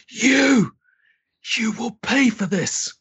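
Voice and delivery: high, furious villain shout; enraged boss roar. Acted. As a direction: roar it at the intruders. Enraged villain turns on players.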